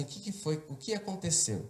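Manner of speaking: softly imitated concerned mother; familiar voice. Mother asking the people around her child what happened, soft retold question. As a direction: ask it quietly, well below storytelling level. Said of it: "eu ouço uma voz conhecida".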